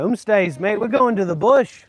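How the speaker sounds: hammed-up Australian exclamation; mock Australian. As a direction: call it out like a true blue Aussie. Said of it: "I sound like a fucking true blue Aussie".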